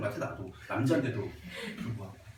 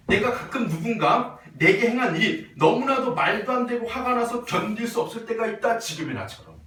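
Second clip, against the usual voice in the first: raised, measured classroom reading with firm stresses; reading aloud; 5 semitones higher, 4 dB louder than usual. A narrator's reading. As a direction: read the passage out clearly to the class. Reads the text aloud. (또박또박 높여 읽는 낭독조)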